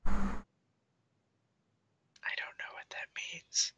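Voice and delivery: hushed, puzzled whisper. Acted. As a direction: whisper back without any voice. Whispered reply to the whispered secret.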